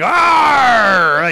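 a short, loud pirate growl of Arr. Retold or imitated. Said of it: "You sound like a pirate".

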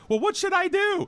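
high, small helpless voice of the mother; a pregnant mother asks the doctors timidly, high and helpless; meek mother. Retold or imitated. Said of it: "She goes, she goes".

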